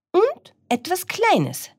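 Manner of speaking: very high hen voice; cackling hen. Acted. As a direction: pipe up like a hen. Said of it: "gackerte die Henne".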